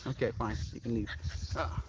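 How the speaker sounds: usual speaking voice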